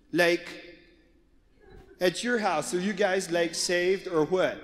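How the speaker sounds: lightly voiced valley girl, slow drawl. Daughter asking her friend about salvation, valley girl drawl. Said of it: "a valley girl".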